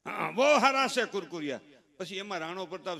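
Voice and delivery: ordinary delivery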